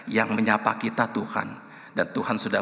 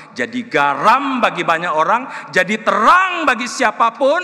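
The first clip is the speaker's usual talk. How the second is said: rising to a loud, high shout; full-force preaching; 11 semitones higher, 11 dB louder than usual. Real, not acted. Preacher calling congregation to be salt and light, impassioned shout. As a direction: build to an impassioned peak.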